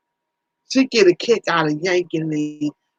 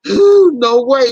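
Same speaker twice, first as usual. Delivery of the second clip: loud refusal; 4 semitones higher, 7 dB louder, slower than usual. Real, not acted. Refusing the garbage idea.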